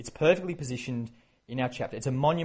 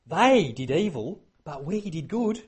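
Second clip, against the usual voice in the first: sly excuse; 4 semitones higher, 5 dB louder than usual. Retold or imitated. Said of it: "his little game there".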